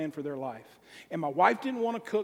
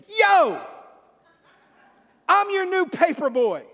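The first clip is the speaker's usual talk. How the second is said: much higher, loud brash yell; brash shout; 10 semitones higher, 9 dB louder than usual. Retold or imitated. Paper boy yelling his greeting at the homeowner, brash shout. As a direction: bellow it like a cocky stranger at the door. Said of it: "he goes".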